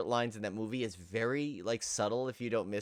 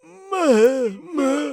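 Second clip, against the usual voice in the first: much higher, much louder wailing creature call; loud raspy mutant cry; 13 dB louder than usual. Retold or imitated. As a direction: wail it like a mutated monster mother. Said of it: "loud, raspy, throaty voice".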